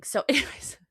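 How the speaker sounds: a breathy laugh over the tears; teary chuckle; laughing through tears. Real, not acted. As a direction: laugh it off mid-cry.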